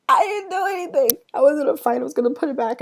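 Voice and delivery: indignant protest. Retold or imitated. Protesting innocence.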